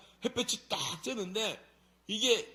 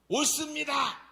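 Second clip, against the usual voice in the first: high, loud mock defiance; imitating an insolent, temperamental prophet; defiant back-talk; 10 semitones higher, 7 dB louder than usual. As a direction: talk back stubbornly at full voice. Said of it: "이렇게 개기는 놈 처음 봤네".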